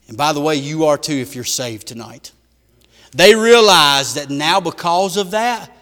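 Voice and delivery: ordinary talk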